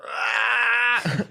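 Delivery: high, strained little cry; imitated tripping yelp; mock yelp. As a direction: squeal like someone lost in a blanket cocoon. Friend wrapped in blankets yelping.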